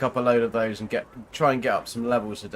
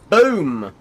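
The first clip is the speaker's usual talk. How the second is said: loud triumphant "boom"; excited shout; 4 semitones higher, 9 dB louder than usual. Real, not acted. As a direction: punch it out with glee. Door opens, loud exclaim.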